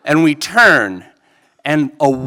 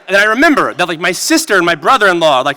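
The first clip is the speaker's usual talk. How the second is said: ordinary talk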